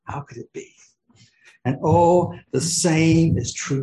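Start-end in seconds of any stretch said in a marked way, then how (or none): 0.0-0.7 soft whisper of wonder. Shown as a demonstration. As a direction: breathe the question in awe. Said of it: "How could this glorious God".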